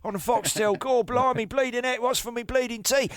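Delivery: high-pitched cockney caricature; hammed-up cockney moaner; Jim from East End. Shown as a demonstration. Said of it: "I love to do, uh, Jim".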